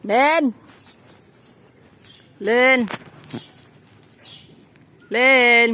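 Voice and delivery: loud, high drawn-out call; calling someone's name. Real, not acted. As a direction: holler the name toward the house.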